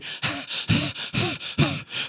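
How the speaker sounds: heavy, voiceless puffs of breath; mock panting. Preacher to congregation after a long reading, exaggerated panting. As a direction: pant as if worn out.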